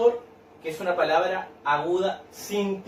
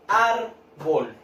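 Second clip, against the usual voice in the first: "Árbol" broken slowly into ar and bol; split into syllables. Shown as a demonstration. Teacher separates the word for children, split into syllables. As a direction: say "Árbol" in two separate beats. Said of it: "la separé en sílabas".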